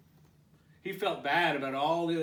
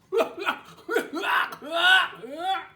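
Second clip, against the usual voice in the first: 1 big hearty guffaw; 11 dB louder than usual. Acted. Cowboy laughs.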